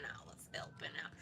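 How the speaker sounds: soft sneaky whisper; whispered excuse. Pretend. Player excuses taking thirteen dollars, whispering. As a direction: whisper a self-justification.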